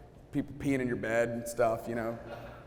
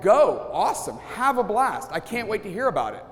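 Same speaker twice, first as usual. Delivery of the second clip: bright, higher, louder cheer; enthusiastic wife; 6 semitones higher, 4 dB louder than usual. Retold or imitated. Wife sending husband off to his friends, eagerly cheering. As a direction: cheer him out the door like a delighted wife.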